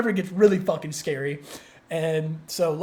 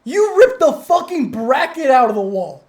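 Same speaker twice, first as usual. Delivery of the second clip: amazed coworker; 6 semitones higher, 8 dB louder than usual. Retold or imitated.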